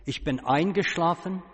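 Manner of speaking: ordinary talk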